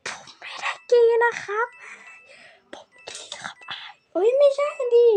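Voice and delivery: whispered aside, sly and hushed; joking whisper. Pretend. Gamer teasing the man in the game, in a whisper. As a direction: breathe it like a secret confession. Repeats joke line in whisper.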